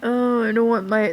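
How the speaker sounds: usual speaking voice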